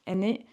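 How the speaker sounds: Aîné, both vowels clear and even; aîné as eh-NAY. Shown as a demonstration. Teacher models Aîné for students, clearly pronounced. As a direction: say Aîné as eh-NAY, rising gently. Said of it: "pronounced like aîné".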